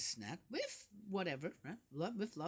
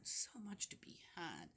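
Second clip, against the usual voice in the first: soft whisper; 9 dB quieter than usual. Real, not acted. Musing on nature's medicine.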